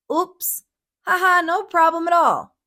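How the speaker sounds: high, bright sugary brush-off; overly sweet. A narrator's reading. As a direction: laugh it off as the sweetest grape. Sweet grape brushing off annoyance.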